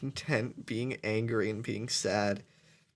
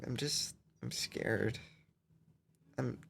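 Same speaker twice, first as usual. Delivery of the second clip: crying, worn out; 3 semitones lower, 5 dB quieter than usual. Real, not acted. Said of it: "I'm tired of crying".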